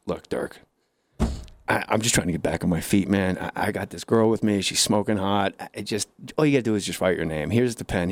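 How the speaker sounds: low-pitched ex-con making his case; ex-con pleading. Acted. Voicing ex-con pleading again.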